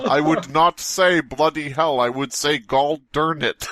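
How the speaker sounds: slow, high put-on voice, lightly American; a hint of a folksy American drawl; mock American denial. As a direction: deny being British, hammed-up. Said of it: "he comes on and he says".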